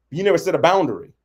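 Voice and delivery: usual speaking voice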